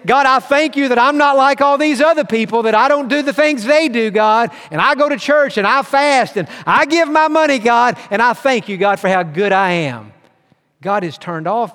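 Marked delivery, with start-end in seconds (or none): 0.1-10.1 proud Pharisee. Retold or imitated. Preacher mocking the Pharisee's proud prayer, hammed-up smug. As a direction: pray like you're showing off to God. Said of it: "The other part is high and mighty".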